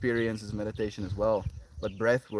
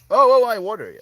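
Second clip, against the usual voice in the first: startled yelp; 10 semitones higher, 13 dB louder, faster than usual. Real, not acted. Startled by dog.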